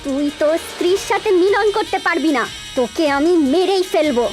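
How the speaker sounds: high, fierce cursing vow; vengeful fury. Acted. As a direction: curse him with burning rage. Snake-woman vows vengeance on killer. (ক্রুদ্ধ নাগিনের প্রতিশোধের শপথ)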